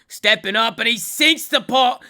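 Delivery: loud, high, slow triumphant call; excited sports call. Acted. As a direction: call the winning putt big. Calling the putt sinking.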